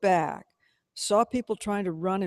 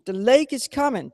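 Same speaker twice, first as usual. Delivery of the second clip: gasped alarm; 6 semitones higher, 6 dB louder than usual. A narrator's reading. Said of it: "T K gasped".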